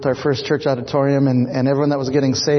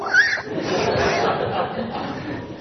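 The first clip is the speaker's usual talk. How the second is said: soft unvoiced laugh, falling away; breathy laugh; 9 semitones higher, 5 dB quieter than usual. Real, not acted. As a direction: let a quiet laugh trail off. Laughs after telling.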